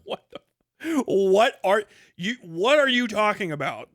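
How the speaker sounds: half-laughing what are you talking about; incredulous. Real, not acted. Ranting at hardline believers, incredulous and loud.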